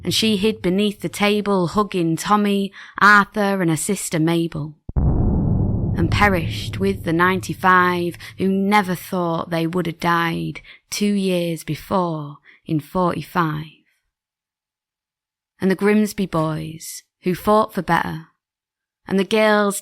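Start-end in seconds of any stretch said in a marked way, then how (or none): none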